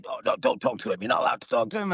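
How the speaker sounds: ordinary talk, nothing marked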